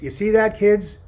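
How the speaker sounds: put-on loud, high call to the kids; camp counselor calling. Camp counselor calling kids on a nature walk, loud and high. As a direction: shout it out to the kids. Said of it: "and he would say to the kids".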